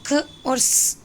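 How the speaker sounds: "ख" and "स" as bare consonant sounds, k and s; isolated letter sounds. Shown as a demonstration. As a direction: sound out "ख" and "स" separately. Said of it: "एक्स की sound".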